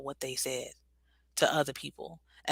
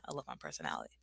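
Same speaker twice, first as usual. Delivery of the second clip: a low, hushed repeat; softly under her breath, much lower; murmured low and soft; 13 semitones lower, 18 dB quieter, much faster than usual. Shown as a demonstration. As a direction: drop low and say it softly to yourself. Repeating affirmation to herself.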